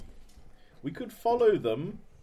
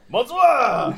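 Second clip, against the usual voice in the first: French greeting; 6 semitones higher, 9 dB louder than usual. Acted.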